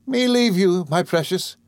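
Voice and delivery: very high, incredulous exclamation; devoted servant's high outcry; crying out. A narrator's reading. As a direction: cry it out in high-pitched disbelief. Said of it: "cried Peggotty".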